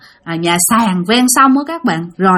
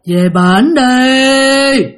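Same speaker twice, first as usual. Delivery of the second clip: moaning ghost voice; 3 semitones higher, 4 dB louder, much slower than usual. Retold or imitated. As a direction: moan it like a ghost rising from below. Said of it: "Mà giọng là giọng của một bà già". (giọng ma bà già rên rỉ, kéo dài rất chậm)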